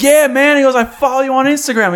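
high, loud eager voice; excited fan. Retold or imitated. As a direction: gush like a fan. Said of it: "he's like".

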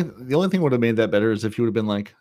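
usual speaking voice

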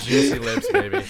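high squeaky laughing tease. Pretend. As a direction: laugh through the words. Teasing friend about juicy lips.

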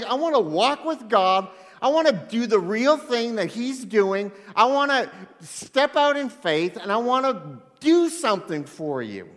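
loud, high, fervent longing; acted-out eagerness, punching each wish; excited. Peter longing to step out in faith, excited. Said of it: "he was excited".

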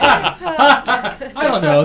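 laughing through the words; slow, drawn out with a laugh. Real, not acted. Laughing after joke about names.

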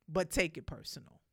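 normal talking voice

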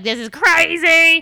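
loud, high, stretched-out exclamation; imitating amazed girls, drawing out the words; mock giddy disbelief. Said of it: "we were dying laughing and being like".

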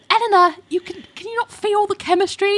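high, pleading mock voice; mock high dramatic plea. Teasing her friend, mock high pleading voice. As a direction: ham up a pleading matchmaker.

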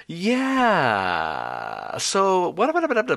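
drawn "yeah" then high "so"; imitating a vocal fry filler. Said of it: "the filler of choice is".

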